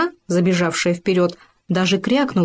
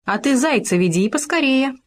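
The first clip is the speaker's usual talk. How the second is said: higher bossy fox voice; sharp fox command; 4 semitones higher than usual. A narrator's reading. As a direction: order Khoma about. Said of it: "прикрикнула она". (лиса покрикивает, выше)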